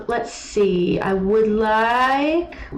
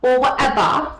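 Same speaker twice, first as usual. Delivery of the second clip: Or whatever, louder and higher; louder mock Owl; 4 semitones higher than usual. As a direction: push the dismissive whatever. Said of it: "He says things like that".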